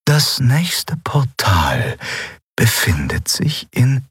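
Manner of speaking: slow breathy whisper, sharing a secret. Acted. Yunnan whispers the portal's location to Endriel. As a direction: lean in and whisper it.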